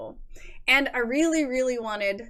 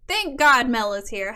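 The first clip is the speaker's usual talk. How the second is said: usual speaking voice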